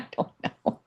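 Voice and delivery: breathy half-laughed whisper; "don't know" whispered under her breath. Real, not acted. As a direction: drop to a whisper, laughing at yourself. Laughing, flustered at the camera.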